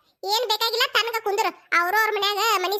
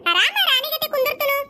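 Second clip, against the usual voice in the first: higher-pitched mock-grand comeback; sarcastic retort; 5 semitones higher than usual. Mother answering daughter who asks what she will do, sarcastic. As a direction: toss it back with raised, indignant pitch.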